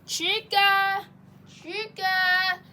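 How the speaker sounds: singled-out name, held long; calling out. Acted. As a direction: call someone over from another room. Calling a missing friend to come.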